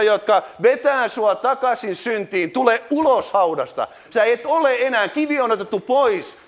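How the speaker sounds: rising, urgent altar-call preaching; rousing preaching. Acted. Preacher calling the congregation to come out, urgent. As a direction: call them out of the grave with building force.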